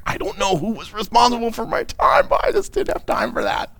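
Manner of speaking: mock helpless excuse-maker; excuse-making. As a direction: act out a feeble excuse. Acts out a whiny excuse.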